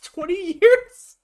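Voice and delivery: high, slow, drawn-out shocked question; shocked disbelief. Real, not acted. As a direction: stretch it out in total disbelief. Shocked at never eating McDonald's.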